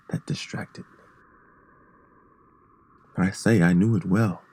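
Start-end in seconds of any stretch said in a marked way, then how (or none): 0.1-1.0 barely audible whisper. A narrator's reading. Narrator whispering of terrors that distracted him. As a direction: let it fade to breath.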